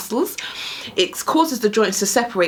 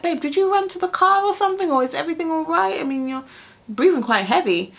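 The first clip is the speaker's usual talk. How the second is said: worried husband; 9 semitones higher than usual. Retold or imitated.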